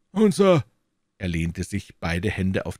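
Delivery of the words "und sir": high and louder, glaring at Holmes; a furious elderly scholar; angry old professor. A narrator's reading. Professor angrily confronting Holmes, high and loud. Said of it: "starrte meinen Gefährten zornig an".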